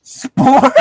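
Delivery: high, loud disbelieving question; incredulous squeak. Real, not acted. Mocking a friend's slip, a squeaky incredulous repeat. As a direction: pounce on the mispronounced word.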